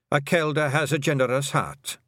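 put-on high, Scots-accented old Pictsie matriarch; a tiny Scottish clan mother, proud and fond; old Kelder voice. The Kelder telling Tiffany about her sons, high and Scottish.

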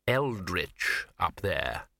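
slow, drawling, haughty cat; sniffy, very slow cat voice; snooty bored cat. Acted.

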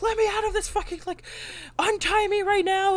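high-pitched mock panic; frantic, high retold demand; freaking out. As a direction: imitate a captive losing his cool. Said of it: "kind of freaking out".

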